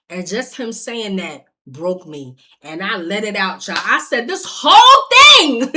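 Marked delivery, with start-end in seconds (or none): 4.3-5.7 high, loud cry breaking out; anguished shout of blame; tearful yell. Retold or imitated. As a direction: she lets it all out at him. Breaking down, yelling at ex.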